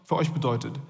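ordinary talk

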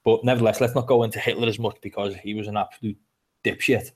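ordinary talk

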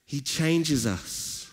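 rising, fervent sermon delivery; preaching. Acted. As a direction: build the praise like a pastor lifting the room. Preaches the passage.

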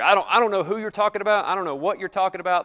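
fast, flustered over-protesting; quick defensive brush-off; rushed nervous denial. Retold or imitated. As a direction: gabble the denial as if caught out. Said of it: "the tone of Peter's response".

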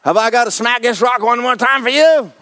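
Moses scolding the rebels, half laughing; exasperated Moses. Acted. Preacher voicing Moses scolding the rebellious people, laughing and exasperated. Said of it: "Sounds like me".

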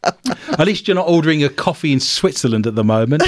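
usual speaking voice

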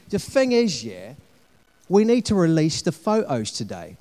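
a rough, self-mocking Cockney; Cockney salesman flattering, half-hearted; Cockney accent. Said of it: "I know my Cockney accent is awful".

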